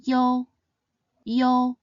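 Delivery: "Yo" said high and clear, like the yo in yo-yo; Mandarin final yo. Shown as a demonstration. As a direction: model "yo" slowly and clearly for learners. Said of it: "Yo sounds similar to the yo in yo-yo".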